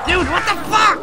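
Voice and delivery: real high, very loud outburst; shocked yell. Real, not acted. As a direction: yell it in high-pitched disbelief. Startled reaction during game fight.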